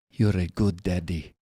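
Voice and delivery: imitated Russian-accented little girl; young girl, Russian accent. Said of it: "it sounded a little Russian".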